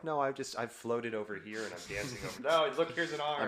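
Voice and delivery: mock flustered. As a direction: babble awkwardly, sending yourself up. Acts out his awkward dance moment.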